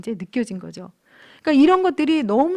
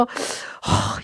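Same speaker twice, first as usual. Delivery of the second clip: unvoiced exasperated huff; acted huff of pent-up anger; seething huff; 3 dB quieter than usual. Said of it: "부글부글 끓으면서". (기가 막혀 내쉬는 한숨)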